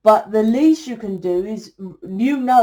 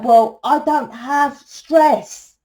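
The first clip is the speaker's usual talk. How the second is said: slow, emphatic and higher; a drawn-out insistence that she has no stress; 4 semitones higher, 4 dB louder, much slower than usual. Real, not acted. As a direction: lay each word down slowly and firmly. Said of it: "I'm like".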